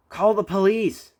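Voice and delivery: loud, slow angry shout. A narrator's reading. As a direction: demand it furiously. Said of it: "they shouted angrily".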